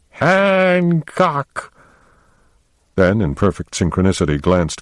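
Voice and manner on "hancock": a high, drawn-out call of the name, mock-yelled. A narrator's reading. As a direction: call the name out like a scolding mom, in fun. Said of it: "They yelled together".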